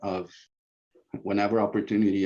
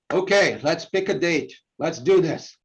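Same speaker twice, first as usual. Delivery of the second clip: excited agreement; 7 semitones higher, 5 dB louder than usual. Retold or imitated. Convert suddenly agrees.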